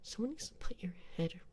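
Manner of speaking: put-on snotty Valley Girl taunt, partly whispered; Valley Girl accent. Streamer taunting chat as a bratty Valley Girl. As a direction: sneer at them like a mean Valley Girl. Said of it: "put on a more, uh, Valley Girl Gato accent".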